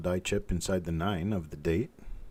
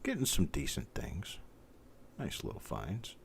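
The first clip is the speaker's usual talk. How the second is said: whispering; 7 dB quieter than usual. Real, not acted. Quiet aside to self.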